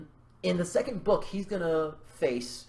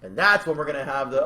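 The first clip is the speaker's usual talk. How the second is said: ordinary talk, nothing marked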